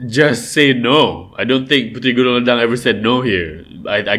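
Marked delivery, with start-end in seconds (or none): 0.1-1.3 "just say no" said slowly, each word stressed; slow emphatic. Shown as a demonstration. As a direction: lean on "just say no", drawn out. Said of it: "the lesson is".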